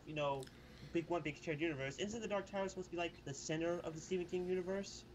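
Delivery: ordinary delivery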